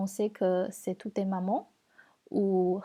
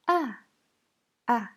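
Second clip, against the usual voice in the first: "A" short and falling from high; fourth tone; 3 semitones higher, 5 dB louder than usual. Shown as a demonstration. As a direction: drop each "A" sharply. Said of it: "Les quatre tons".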